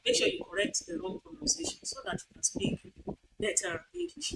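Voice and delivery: usual speaking voice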